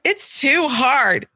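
slow, whining daughter's complaint; whiny child. Retold or imitated. Daughter complaining about schoolwork, slow and whiny. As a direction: drag it out like a sulky kid. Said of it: "the dance that you're usually doing".

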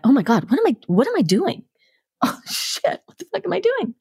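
high, hushed panicked self-talk; retold jolt of panic, pitch climbing; sudden panic. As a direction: suddenly realize with horror, voice rising high. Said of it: "my whole body got adrenalized".

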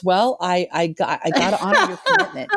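imitating her dad chuckling mid-sentence; a retold father still giggling as he speaks; laughing dad. Dad laughing while quoting him.

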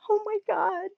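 high, breathless oh my God; sudden rush of delight at a discovery; amazed gasp. Retold or imitated. Telling a friend about a meditation ad, thrilled.

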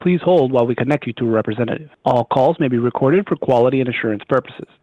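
recorded hold message over the line; automated phone greeting; phone menu voice. Acted.

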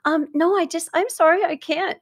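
high, timid, hesitant refusal; nervous apologetic no. Retold or imitated. Woman who can't say no, imitated, timid and high. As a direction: squeak out a flustered apology. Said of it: "She's probably going".

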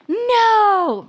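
high, loud drawn-out mock yell. Host imitating a telenovela's yelling, mock-dramatic yell. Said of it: "Like, there's yelling".

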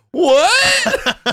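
very high, drawn-out disbelief; astonished outburst. Retold or imitated. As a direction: let the pitch leap in comic shock. Reacts to friend's surprising revelation.

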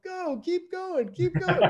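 high-pitched voice urging him to keep going; retold camp staff, high and eager; campers urging. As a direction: squeak it out like an eager crowd urging on. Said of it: "And they're like".

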